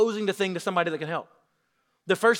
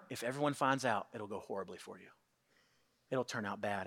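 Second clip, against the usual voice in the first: fear's whisper; 8 semitones lower, 12 dB quieter than usual. Retold or imitated. Voice of fear whispering.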